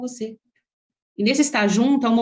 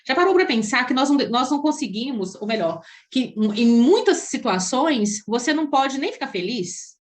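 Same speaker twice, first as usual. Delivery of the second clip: ordinary talk, nothing marked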